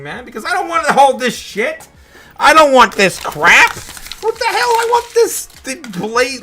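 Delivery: loud, rising, disgusted outburst; venting fury at the cash he holds; angry rant. Real, not acted. Vlogger raging at being stuck with fiat money, angry shout.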